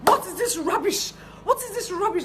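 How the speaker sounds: high, loud mock yell of an angry client; retold shouting. Angry client yelling at tailor over short trousers, mock shout. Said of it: "shouting at the top of her voice".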